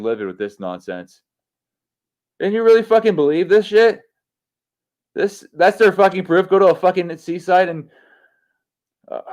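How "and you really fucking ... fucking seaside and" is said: high, loud, outraged disbelief; furious rant. Real, not acted. Streamer ranting to chat about globe proof, higher and louder outrage. Ranting at flat earth debunk.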